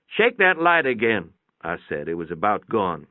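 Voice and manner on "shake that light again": higher, louder call; a nudge shouted from under the car. A narrator's reading. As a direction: call it over to the boy, raised up. Calling to boy.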